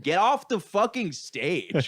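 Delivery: high, drawn-out mock heckle; slow mock holler; heckling crowd. Retold crowd heckling the performer.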